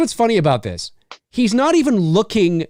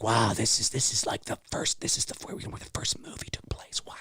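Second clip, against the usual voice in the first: hushed, whispered wonder; little kid's awe; 8 semitones lower, 13 dB quieter than usual. Retold or imitated. Host recalls childhood belief about the film set, awed whisper. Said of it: "I remember when I was little".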